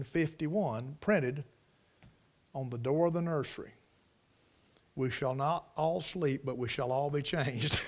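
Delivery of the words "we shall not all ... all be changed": soft, low reading breaking into a laugh; amused deadpan quote. Real, not acted. Preacher reading the nursery door sign as a joke, tickled. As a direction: quietly deliver the verse as a punchline.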